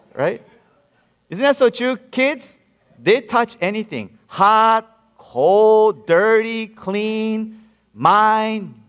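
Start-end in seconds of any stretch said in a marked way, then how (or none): none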